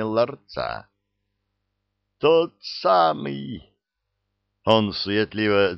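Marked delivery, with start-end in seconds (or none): 2.2-3.7 high, slow, savoured exclamation; higher-pitched relish; awed delight. A narrator's reading. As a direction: draw it out in delighted recognition. Mage recognises the key.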